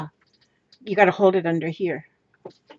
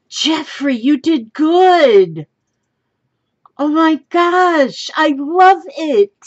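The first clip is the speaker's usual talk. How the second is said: high, drawn-out squeal of delight; delighted gushing; 8 semitones higher, 4 dB louder, slower than usual. Real, not acted. Crafter thrilled by the silk Jeffrey got, high-pitched gushing. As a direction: gush with real thrilled surprise.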